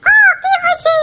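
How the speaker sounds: put-on high, shouting young woman's voice, whining; girl on phone. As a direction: squeal like an upset girl on the phone. Girlfriend Moyna answers phone.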